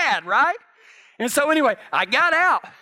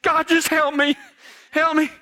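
desperate plea; 8 semitones higher, 3 dB louder than usual. Retold or imitated. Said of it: "I'm like".

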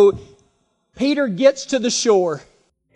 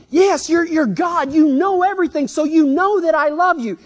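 higher, insistent mock exasperation; acted frustration; 6 semitones higher than usual. Preacher replays Peter's answer to Jesus, frustrated. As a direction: protest it as if asked too many times. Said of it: "he's kind of getting frustrated by now".

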